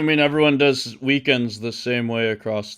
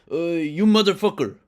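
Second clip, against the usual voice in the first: put-on loose Italian-American New York accent, pitched up and loud; Italian coworker impression; 6 semitones higher, 7 dB louder than usual. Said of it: "that's not a good impression".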